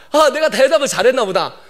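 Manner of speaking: ordinary talk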